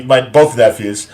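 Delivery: ordinary talk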